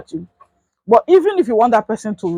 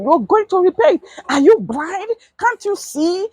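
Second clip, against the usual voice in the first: higher, angry retold shout; imitating a shouting man; 9 semitones higher than usual. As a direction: mimic the driver yelling at the keke man. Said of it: "He started shouting".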